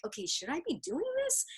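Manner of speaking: retold doubt. First-time skydiver facing waivers, retold hesitant self-questioning. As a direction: voice a small inner doubt.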